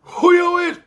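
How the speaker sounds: big, high, loud comic holler; loud high-pitched impersonated catchphrase; Bernie Mac. Acted. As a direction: shout it as an exaggerated impression. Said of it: "do that Bernie Mac voice".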